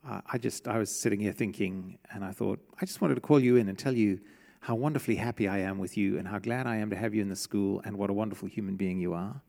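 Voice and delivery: low, soft and fast, gushing praise; a lightly voiced gentle principal beaming at a student; kindly principal. Preacher imagines a principal gently praising a pupil, low and soft. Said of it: "call you into his office and go".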